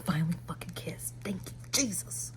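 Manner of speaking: fast, relieved whisper. Real, not acted. Reader whispering relief to her viewers. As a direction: breathe it out like a thankful secret.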